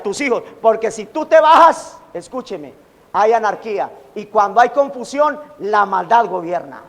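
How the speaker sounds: ordinary talk, nothing marked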